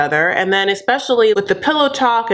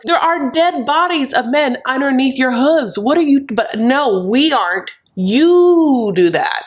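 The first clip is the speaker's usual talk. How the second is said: high, emphatic sassy rant; scandalised scolding, pitched up with stretched words; mock-indignant telling-off; 5 semitones higher than usual. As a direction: tear into them with theatrical outrage. Host mock-scolds Lisa.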